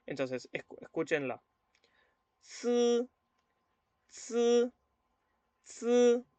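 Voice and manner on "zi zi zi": "zi" as an unaspirated ts, held high and level; ts without air. Shown as a demonstration. Teacher telling learners to listen, "zi" as ts without air. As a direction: hold back the breath on the ts of "zi". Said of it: "la Z sin aire".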